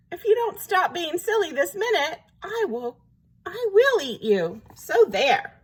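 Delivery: high, huffy threat; hurt feelings. A narrator's reading. Hurt wolf threatens the silly duckling, huffy. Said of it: "feelings were horribly hurt".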